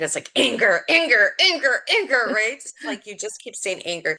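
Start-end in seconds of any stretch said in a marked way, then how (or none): 0.3-2.3 angry inner voice. Retold or imitated. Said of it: "this is the voice in my head".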